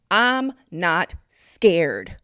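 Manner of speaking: loud, very slow, word by word; forceful, spaced-out declaration; emphatic defiance. Real, not acted. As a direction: declare it with a pause between words.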